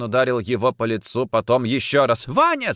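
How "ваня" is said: high wifely scream of a name; alarmed cry. A narrator's reading. Wife calling from the corridor, alarmed cry. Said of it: "закричала жена из коридора".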